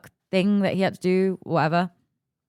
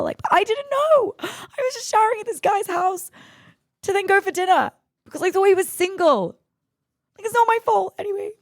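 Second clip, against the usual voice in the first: very high, squealed self-defence; indignant protest; 14 semitones higher than usual. Real, not acted. Defending herself about the married man, high indignant protest. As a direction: protest your innocence way up high.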